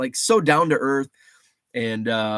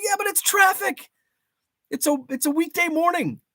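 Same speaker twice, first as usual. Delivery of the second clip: much higher, mock defensive whine; high put-on voice. Retelling his texted excuse to his wife, much higher. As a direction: squeak it out as a silly excuse.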